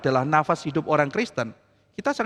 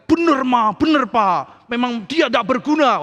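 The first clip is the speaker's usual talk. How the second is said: egging on; 6 semitones higher, 4 dB louder, slower than usual. Acted. Son siding with angry parents.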